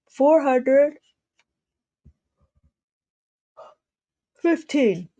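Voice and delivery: words stretched by a yawn. Real, not acted. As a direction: say it mid-yawn. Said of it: "I do apologize for yawning".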